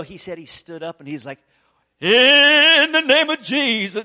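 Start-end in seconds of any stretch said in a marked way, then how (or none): 2.0-4.0 loud, slow, hammed-up healer cry; mock faith healer. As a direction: ham up a showy faith healer proclaiming. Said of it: "he didn't get all squirrely and weird".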